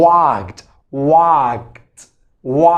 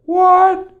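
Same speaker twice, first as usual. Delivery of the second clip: usual speaking voice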